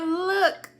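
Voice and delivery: look, laughing and half crying; nervous laugh through the word. Retold or imitated.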